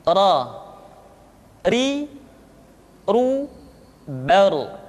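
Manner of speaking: "Ra, ri, ru, bar" with a rolled r; demonstrated ra. Shown as a demonstration. Teacher demonstrating the letter ra. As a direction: tap the r on each syllable. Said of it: "dengan langit-langit".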